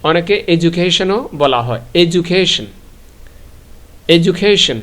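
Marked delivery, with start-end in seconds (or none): 2.0-4.8 এডুকেশন said as "ejukeshon", the d softened to a j; এডুকেশন with j instead of d, repeated twice. Shown as a demonstration. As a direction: say এডুকেশন with a j sound where the d is. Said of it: "ডিটাকে, আহ, বরিকজ্জ উচ্চারণ করে".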